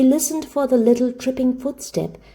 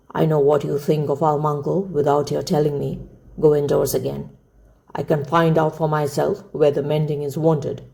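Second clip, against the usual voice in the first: lightly voiced old man, pitched down; curt old uncle; 5 semitones lower than usual. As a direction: cut her off as a curt old man, a little low. Voices the gruff grandfather.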